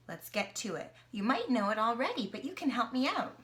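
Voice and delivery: usual speaking voice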